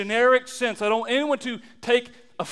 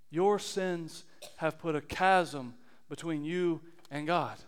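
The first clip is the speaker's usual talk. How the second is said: grave and hushed; 7 semitones lower, 11 dB quieter than usual. Real, not acted. Preacher reading Isaiah's warning to the church, hushed and grave. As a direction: read it gravely, low and quiet.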